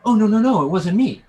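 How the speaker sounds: high, loud flustered denial; startled and upset. Acted. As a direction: protest your innocence in alarm. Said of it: "startled and upset".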